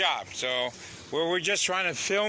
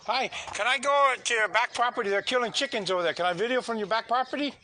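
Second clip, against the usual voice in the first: calling across; 6 semitones higher than usual. Real, not acted. Asking neighbor across yard.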